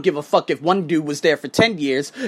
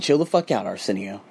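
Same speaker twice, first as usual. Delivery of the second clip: low, hushed self-talk; calming himself; 11 semitones lower, 6 dB quieter than usual. Retold or imitated. Man telling himself to cool off after walking out, subdued. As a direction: talk yourself down, dropping low.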